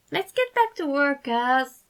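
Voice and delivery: high, whiny plea to get back to work; whine. A narrator's reading. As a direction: whine it like a bored kid. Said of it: "he whined".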